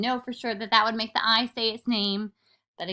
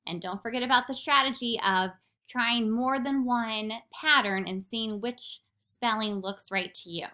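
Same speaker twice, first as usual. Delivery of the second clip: usual speaking voice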